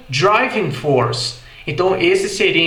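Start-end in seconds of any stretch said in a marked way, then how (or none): 0.1-1.4 slow American "driving force" with R; R sounded in "force", slow; American English. Shown as a demonstration. ("driving force" americano, com R)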